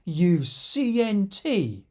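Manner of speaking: mock-indignant insult. Joe stops in his tracks after the joke, mock-indignant. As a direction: spell it out emphatically, letter by letter, as mock outrage.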